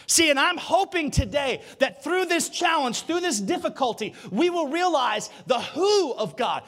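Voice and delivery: loud, rising sermon cry; preaching. Real, not acted. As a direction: preach it out full force. Preaching at full force.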